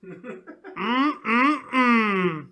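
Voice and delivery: long high hum of delight, laughing through it; appreciative laughing hum. Real, not acted. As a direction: savour the thought with a giggly mm. Drooling over attractive cast.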